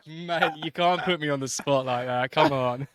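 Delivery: mock-exasperated, half laughing; laughing protest. Retold protest at being put on spot.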